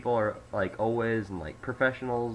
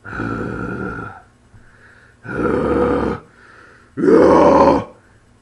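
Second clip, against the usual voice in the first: loud, deep fry scream; low fry scream; 6 semitones lower, 17 dB louder than usual. Shown as a demonstration. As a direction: push a long growl from the gut. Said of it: "so it'd be like".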